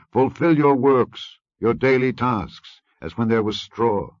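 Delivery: loud, slow, driving command; harsh taskmaster order. Acted. Taskmasters drive the slaves, loud slow orders. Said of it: "taskmasters hasted them".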